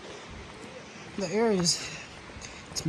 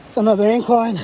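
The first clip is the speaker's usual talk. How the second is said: out of breath; 4 dB quieter than usual. Real, not acted. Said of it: "I'm out of shape".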